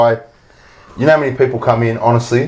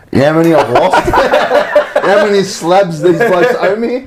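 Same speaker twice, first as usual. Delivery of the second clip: laughing disbelief; 7 semitones higher than usual. Real, not acted. Laughing about lost bets.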